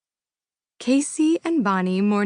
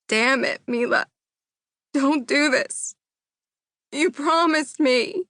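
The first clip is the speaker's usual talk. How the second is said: desperate pleading cry; 7 semitones higher than usual. Acted. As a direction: cry out to her, begging. Husband pleading as she leaves.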